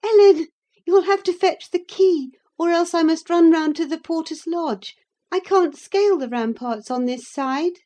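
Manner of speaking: put-on high, loud girlish exclamation; exclaiming young lady. A narrator's reading. Young lady stuck outside calling to Ellen, exclaiming. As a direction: call out brightly, pitched well up. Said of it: "laughing and exclaiming".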